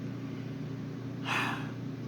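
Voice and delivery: long breathy sigh; weary sigh. Acted. Vegeta sighing before speaking to the Kais, weary sigh. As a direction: let out a long tired sigh. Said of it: "Vegeta sighs".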